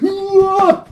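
high, loud panicked scream; terrified scream. Acted. Three friends scream at the laughter. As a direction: scream in terror.

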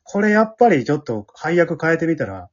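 ordinary talk

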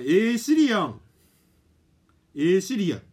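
high, loud, drawn-out call; stretched loud call to the phone; calling out to Siri. Acted. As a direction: sing it out loud to wake the phone.

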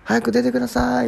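high, insistent calling voice; impatient pleading. Retold or imitated. Visitor outside urging the resident to hurry, imploring. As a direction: urge someone out with a stretched call. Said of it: "待ちわびてるような".

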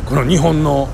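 ordinary talk, nothing marked

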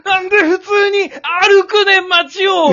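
very high, drawn-out comic shout; exaggerated outraged Kansai heckle, half laughing; mock Kansai tsukkomi. Imagined Kansai partner heckling him for walking, mock outraged yell. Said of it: "とか言われたら".

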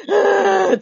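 put-on child's sob. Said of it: "つって".

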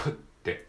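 プ as an unvoiced lip pop, like spitting a seed; demonstrating the P sound; puffed P. Shown as a demonstration. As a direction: blow プ out with no voice. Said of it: "スイカの種を飛ばすように".

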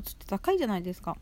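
ordinary talk, nothing marked

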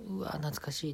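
tiny whispered aside; small whisper. Shown as a demonstration. Comic demonstrates whispering to himself in restaurant. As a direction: breathe it barely audible. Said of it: "次、ちっちゃい".

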